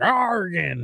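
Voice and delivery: Oregon as a drawn-out pirate "Arr-gin". Acted. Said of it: "like, a pirate".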